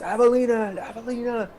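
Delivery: high-pitched scream held down in volume; mock screaming. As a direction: cry the name out as if from afar. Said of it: "just screaming her name".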